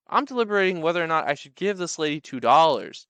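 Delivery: normal talking voice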